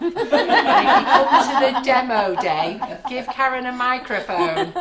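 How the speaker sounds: loud, high, real laughter through the words; tickled, teasing a colleague; laughing. Real, not acted. Joking about microphone-hogging colleague, laughing.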